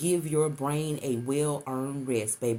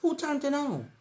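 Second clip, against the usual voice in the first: high, wondering question; puzzled; 11 semitones higher than usual. Retold or imitated. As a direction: ask it baffled. Waking to find TV on.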